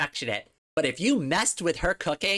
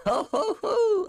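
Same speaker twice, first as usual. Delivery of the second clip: high hammy ho ho ho; goofy Santa laugh; slower than usual. Pretend. Streamer tries a goofy exit sound, high ho ho. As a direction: boom out a jolly mock laugh. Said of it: "exit with a goofy scream".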